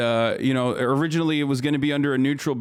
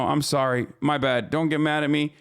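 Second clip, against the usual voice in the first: usual speaking voice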